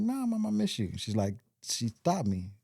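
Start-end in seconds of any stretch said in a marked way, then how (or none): 0.0-0.9 high-pitched insisting, re-enacted; retold emotional denial. Insisting to grandmother that nothing is wrong, high emotional denial. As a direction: replay the emotional excuse, pitched well up. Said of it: "I get emotional".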